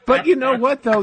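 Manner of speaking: high laughter running into words; laughing. Real, not acted. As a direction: laugh through the start of the line. Laughing at union boss quote.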